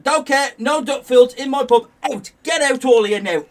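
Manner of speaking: loud, flat refusal; angry barman. Retold or imitated. Barman throwing the whole team out loudly. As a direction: clear the whole lot out, loudly.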